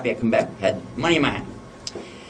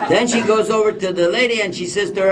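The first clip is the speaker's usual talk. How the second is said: ordinary talk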